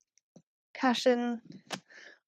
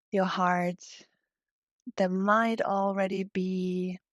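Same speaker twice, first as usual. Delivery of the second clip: guided meditation. Real, not acted. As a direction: guide them gently into breathing, unhurried. Leads a heart-centred yoga meditation.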